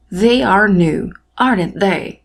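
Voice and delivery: slow model English. Shown as a demonstration. Teacher modelling English example.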